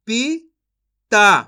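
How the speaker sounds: पिता very slowly split as "पी ... ता", loud; syllable by syllable. Shown as a demonstration. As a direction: sound out पिता in two separate pieces. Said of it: "एक अक्षर का उच्चारण करके". (पिता को तोड़कर, धीरे-धीरे)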